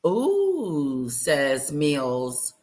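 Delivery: normal talking voice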